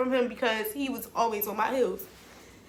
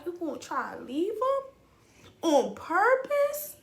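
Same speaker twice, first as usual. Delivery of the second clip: higher pitch, drawn out on "on purpose"; incredulous self-scolding; 6 semitones higher than usual. Retold or imitated. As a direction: challenge yourself in disbelief, voice climbing. Retold scolding of herself.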